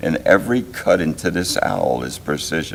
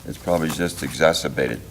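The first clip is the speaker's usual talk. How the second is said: usual speaking voice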